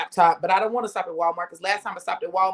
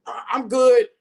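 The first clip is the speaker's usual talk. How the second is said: choked dad voice; 5 semitones higher, 5 dB louder than usual. Retold or imitated. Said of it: "He's like".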